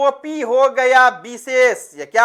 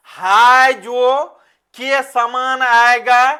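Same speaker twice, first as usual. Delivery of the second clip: हाय जो drawn out very slowly and clearly; slow key phrase; 4 dB louder, much slower than usual. Shown as a demonstration. Teacher to students, stressing the trick phrase slowly. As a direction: spell out "हाय जो के समान" word by word. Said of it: "हम बेटा विग्रह करेंगे".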